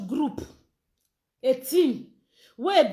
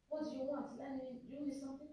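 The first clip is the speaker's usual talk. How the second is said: much softer, off-mic question; quiet aside; 19 dB quieter, faster than usual. Real, not acted.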